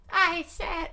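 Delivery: very high, loud squeak; squeaky voice. Shown as a demonstration. Reader tries a voice for the giant, squeaky. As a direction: pipe it out in a squeaky falsetto. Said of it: "Let's go with a squeaky voice".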